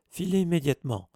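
loud, high command to get out; shouting. Acted. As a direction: bark the order at them. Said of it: "s'écria Percy".

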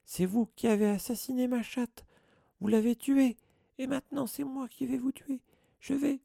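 high, shrill, frantic threats; a grief-crazed old man screeching accusations; shrill furious caretaker. A narrator's reading. Caretaker threatening the boy over his cat, shrill furious screech. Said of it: "cria-t-il d'une voix stridente".

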